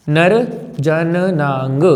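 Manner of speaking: very slow, drawn-out syllables with a pause; stretched teacherly dictation. Shown as a demonstration. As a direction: spell out नर जननांग piece by piece. Teacher dictates term piece by piece.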